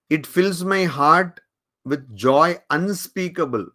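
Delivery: extremely slow, each word drawn out; slow solemn quote. Retold or imitated. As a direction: savour the words as a sacred quotation. Quoting Vivekananda's speech. (অত্যন্ত ধীরে, গম্ভীর উদ্ধৃতি)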